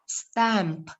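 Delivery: stamp with an open A and a final P; stamp, end on a clear P. Shown as a demonstration. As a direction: stamp said carefully with the P. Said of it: "Tapi ini bacanya".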